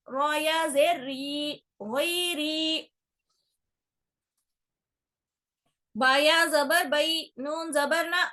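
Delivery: slow, drawn-out sing-song letter drill; Qaida spelling recitation. Shown as a demonstration. Teacher reciting Qaida letters for a young pupil, slow and sing-song. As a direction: spell out each syllable slowly for a child to repeat.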